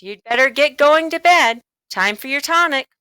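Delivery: brisk mother sending him to bed; mother's voice. Acted. Mother telling John to go to bed, high and brisk. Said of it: "said Missus Midas".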